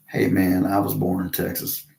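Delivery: dropping into a native Texas accent; a Texas accent suddenly let out; own Texas voice. Shown as a demonstration. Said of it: "That quick as heck".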